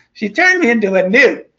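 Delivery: high, loud, whiny peasant accusing a witch; Monty Python villager. Retold or imitated. Retelling Monty Python to the class, high mock villager whine. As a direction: squeal the accusation in comic outrage. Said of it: "Do you remember Monty Python and the Holy Grail".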